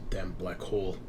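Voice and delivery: low, soft grumbled curse under the breath; muttered curse. Acted. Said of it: "you hear Drabikowski curse".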